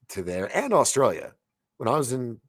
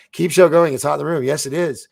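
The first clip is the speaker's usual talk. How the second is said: much higher, louder gleeful reread; excited; 6 semitones higher, 10 dB louder than usual. Shown as a demonstration. Rereading a viewer's chat line loudly, delighted. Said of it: "repeats chat line laughing".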